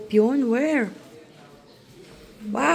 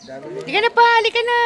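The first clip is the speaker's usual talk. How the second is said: calling out; 10 semitones higher than usual. Real, not acted. Mother calls again, more insistent.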